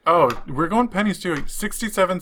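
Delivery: ordinary talk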